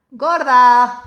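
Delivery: put-on loud drunken shout; drunk yelling. Corner drunk shouting an insult at a passer-by. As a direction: bellow it across the street. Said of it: "te grita".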